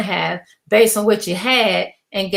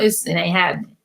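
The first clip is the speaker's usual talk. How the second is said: ordinary talk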